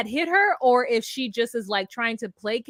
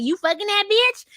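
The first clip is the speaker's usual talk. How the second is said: mock accusation; 8 semitones higher, 4 dB louder than usual. Acted quarrel, woman accuses man.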